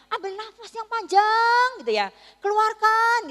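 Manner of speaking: loud, high, drawn-out coaching call; nurse coaching a birth. Retold or imitated. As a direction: urge her through labour like a nurse.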